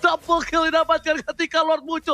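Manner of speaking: rising hype play-by-play; fighting game announcer. Acted. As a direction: call it like a big fight. Hyped esports casting.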